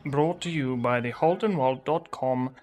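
deep, formal radio sign-off; vintage newsreel closing; old radio announcer. Acted.

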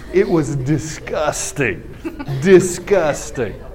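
slow, deliberate verdict; drawn-out disgust. Real, not acted. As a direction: let each syllable drag with revulsion. Dwells on the disgust for effect.

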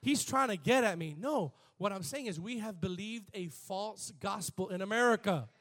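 usual speaking voice

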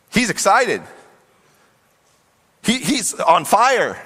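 high, swooping exclamation; excited. Retold or imitated. Preacher describing Bartimaeus running to Jesus, high and excited. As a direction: let excitement lift the pitch.

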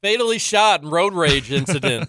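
hammed-up newsreader delivery; deadpan parody of a headline read; mock news headline. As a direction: read it like a local news anchor.

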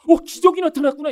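high, loud mock amazement; big surprised outburst, put on; acted astonishment. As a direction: exclaim as if stunned by a miracle. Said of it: "이렇게 놀라셔야죠".

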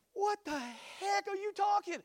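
high-pitched incredulous question; bewildered retort. Retold or imitated. Driver baffled by a yelling stranger, high incredulous voice. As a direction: squeal it in mock bafflement.

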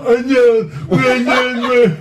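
high, loud mocking shout of a garbled voice; imitating a strange guy. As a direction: ham up a loud slurred yell. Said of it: "this one guy that was all".

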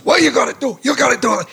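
high, insistent coaching voice; lightly voiced old director instructing; Mel Brooks directing. Director coaching an actress, high insistent voice. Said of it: "he goes".

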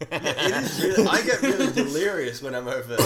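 laughing. Real, not acted. Laughing loud delirious heat talk.

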